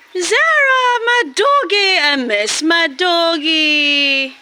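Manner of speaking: high sing-song call, drawn out slowly; fond calling. Real, not acted. Vlogger calling fondly to her dogs by the coop. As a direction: croon the names like greeting beloved pets.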